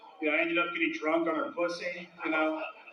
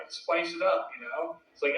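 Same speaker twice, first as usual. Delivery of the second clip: ordinary talk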